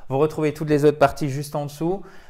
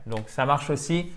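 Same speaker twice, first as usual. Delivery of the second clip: normal talking voice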